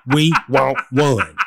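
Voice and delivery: slow, heavy demand. Retold or imitated. The US barging into the meeting, slow demand. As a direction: stretch each word out like a bully at the table. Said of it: "That's exact".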